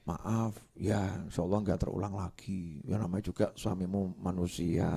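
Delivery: meek apology. Retold or imitated. Suami minta maaf pada istri.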